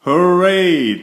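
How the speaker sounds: high, loud hooray; cheering cry. A narrator's reading. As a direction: cheer at the top of the voice. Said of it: "they all cried".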